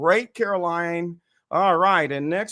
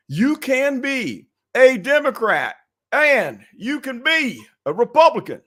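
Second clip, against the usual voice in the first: Barack Obama; 7 semitones higher, 5 dB louder than usual. Real, not acted. Host welcomes every party to the discussion, sounding like Obama. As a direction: stretch it out like a politician's stump speech. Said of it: "Why do I sound like Barack Hussein Obama?".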